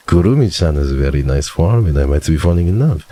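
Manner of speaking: put-on French accent, smitten; hammed-up, love-struck French accent. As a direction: play the French romantic. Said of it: "you still have a French accent".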